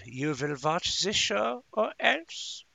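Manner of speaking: a lightly voiced made-up alien Kraplakian accent, slowing to a threat; hammed-up Kraplakian accent on a mock threat. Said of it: "That is a Kraplakian accent".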